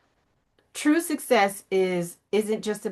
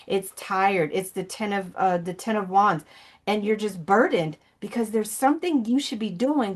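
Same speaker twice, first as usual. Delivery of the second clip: normal talking voice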